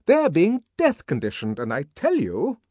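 very high, loud, indignant nurse; outraged disbelief; intense. Acted. Nurse arguing back at savage.

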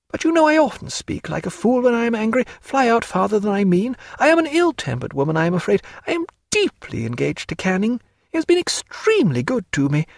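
a hint of a lively lady mocking her paint; Diana. A narrator's reading. Narrator voicing Diana.

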